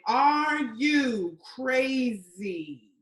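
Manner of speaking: drawn-out, very slow disbelief; incredulous reply. Acted. Woman fires back at his post, drawn-out incredulity. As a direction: stretch every word in disbelief. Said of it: "I responded".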